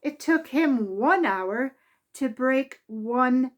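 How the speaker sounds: lively, expressive read-aloud voice; children's story reading. A narrator's reading. Narrator reading a Mister Men story to children, lively and expressive. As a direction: read it to a room of small children. Reads picture book aloud.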